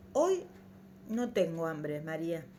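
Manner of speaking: softly voiced, sinking low after the first word; hushed voice. A narrator's reading. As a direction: say it faintly, letting the voice drop away. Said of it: "con voz queda".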